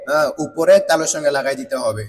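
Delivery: usual speaking voice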